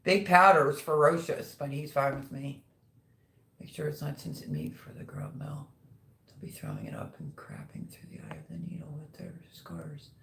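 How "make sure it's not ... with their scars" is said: a private unvoiced whisper, very soft; whispered aside. Real, not acted. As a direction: whisper so the viewers can't hear. Side remark kept from the live.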